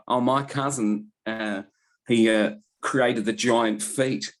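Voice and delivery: mock Kiwi accent, slow and drawn out; doing a Kiwi local bragging about the film in a New Zealand accent. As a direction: lightly put on New Zealand accent, dragging the words. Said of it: "Good accent".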